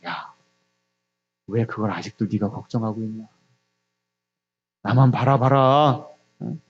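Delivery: low, hushed voice of God, slowing at the end; God's voice. Acted. God telling a worried believer to look to Him, low and quiet. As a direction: speak low and soft, like God reassuring a worrier. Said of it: "그때 하나님의 음성을 들어요".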